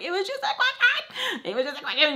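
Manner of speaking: very high squeaky pleading; hammed-up, high-pitched whine of innocence; whiny protest. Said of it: "And I'm like".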